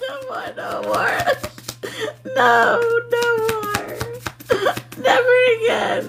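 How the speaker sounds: giggling high never again; laughing protest. Real, not acted. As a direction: squeal it through laughter.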